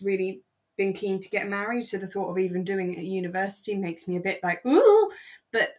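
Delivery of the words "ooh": high, loud recoiling ooh; ooh of horror. Retold or imitated. Speaker recoils at marrying young, loud high ooh. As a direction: squeal of mock dread.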